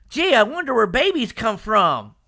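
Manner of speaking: high, loud goofy mock of a clueless Goku; dumb Goku voice. Mocking how Goku should not sound, in a goofy high voice. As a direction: play him wide-eyed and dim.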